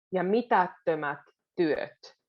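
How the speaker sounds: ordinary talk, nothing marked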